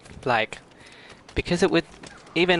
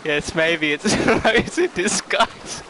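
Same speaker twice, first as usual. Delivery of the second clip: higher, slow words broken by giggles; laughing; 5 semitones higher than usual. Real, not acted. Streamer joking with chat about the bot, laughing. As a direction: struggle to talk through real laughter. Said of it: "now you've got me laughing".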